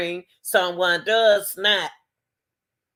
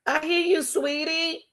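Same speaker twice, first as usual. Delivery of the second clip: mock dismissive partner; 8 semitones higher, slower than usual. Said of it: "When you hear somebody say".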